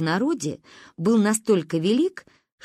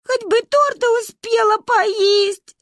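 high, slow wailing lament; keen like orphans; 4 dB louder, slower than usual. A narrator's reading. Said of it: "сокрушались мы в унисон". (причитание)

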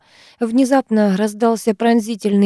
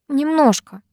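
higher, small-voiced admission; reluctant admission; 7 semitones higher, slower than usual. A narrator's reading. Вита admitting to rescuer she is hurt, reluctant. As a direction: admit it timidly. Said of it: "призналась она".

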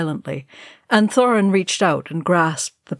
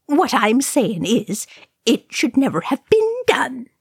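angry agreement; 7 semitones higher than usual. A narrator's reading. Balin agreeing with Oin, indignant protest. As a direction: insist with pitch climbing on the complaint. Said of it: "Balin rumbled his angry agreement".

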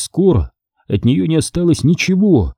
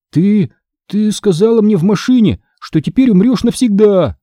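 high, stammered start; mildly choked, high-pitched accusation; forced-out, halting; 8 semitones higher than usual. A narrator's reading. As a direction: struggle to get the accusation out. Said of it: "Еле выдавил я".

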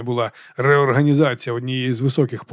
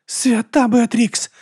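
high, very slow moan of horror; 10 semitones higher, much slower than usual. Acted. Monk watching her spine twist, moaning. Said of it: "застогнав брат Діас".

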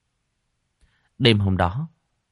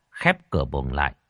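usual speaking voice

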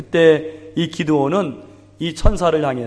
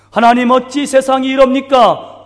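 mock complaint; 8 semitones higher, 6 dB louder than usual. Imitated complaint to God.

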